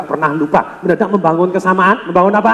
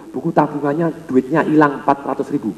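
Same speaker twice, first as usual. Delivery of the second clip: ordinary talk, nothing marked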